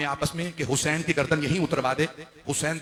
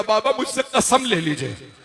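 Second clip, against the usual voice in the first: higher, louder plea, building; desperate pleading; 6 semitones higher, 6 dB louder than usual. A narrator's reading. Sick daughter begs her father to take her, pleading. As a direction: beg with rising pitch and force.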